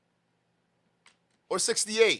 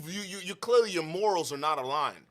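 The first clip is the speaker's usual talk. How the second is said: ordinary talk, nothing marked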